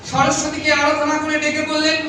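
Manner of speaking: ordinary talk, nothing marked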